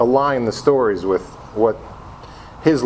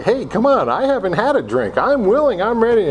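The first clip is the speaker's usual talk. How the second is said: higher, insistent mock plea; eager pleading; 9 semitones higher than usual. Jealous newcomer wants the sponsor's attention, higher eager plea. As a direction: pitch up, begging to be noticed.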